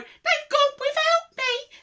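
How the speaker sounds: slow, very high lament; hammed-up falsetto woe; wailing cry. A narrator's reading. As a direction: wail it like an abandoned little dinosaur. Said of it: "And he cried".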